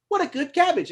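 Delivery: high, doting cabbage seller; cabbage man voice. Acted. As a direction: coo over the cabbage in a high voice. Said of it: "he's like".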